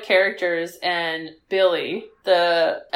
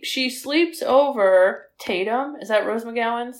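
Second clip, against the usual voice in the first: ordinary talk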